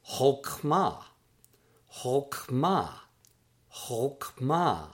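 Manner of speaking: "chokmah" as hoke-MAH, falling at the end; pronunciation demo. Shown as a demonstration. Teacher models the word for wisdom, careful pronunciation of "chokmah" as hoke-MAH. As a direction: say "chokmah" carefully in two clear syllables. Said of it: "that is pronounced in Hebrew as".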